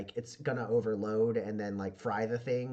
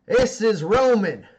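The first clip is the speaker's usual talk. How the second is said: higher, louder, slow boss announcement; boss addressing crew; 10 semitones higher, 13 dB louder, slower than usual. Acted. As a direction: announce the newcomer to the gang loudly. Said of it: "And she goes".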